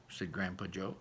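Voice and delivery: ordinary delivery, nothing marked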